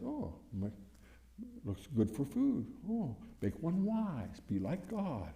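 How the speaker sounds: soft, slow, drawn-out temptation; slick Satan. Acted. Satan selling the fruit to Eve, slick and hushed. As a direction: sell it smoothly and quietly, stretching the words. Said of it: "Satan is slick ... knows how to sell".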